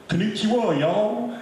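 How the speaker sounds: put-on high Southern-accented Japanese. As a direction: greet them in Japanese with a Southern twang. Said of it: "Japanese with a Southern accent".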